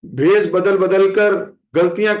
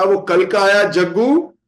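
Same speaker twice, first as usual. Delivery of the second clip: higher, louder, slow scolding; stern rebuke; 7 semitones higher, 3 dB louder than usual. Retold or imitated. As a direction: dress him down with rising heat. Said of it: "महाप्रभु ने कहा".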